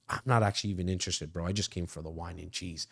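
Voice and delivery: low, soft, offhand brush-off; uninterested client. Acted.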